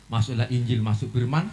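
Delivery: ordinary talk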